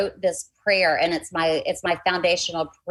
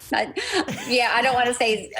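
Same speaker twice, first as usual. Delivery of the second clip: laughing; 7 semitones higher than usual. Real, not acted. Laughing over a painful memory.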